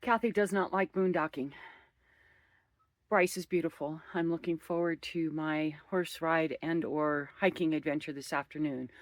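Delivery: ordinary delivery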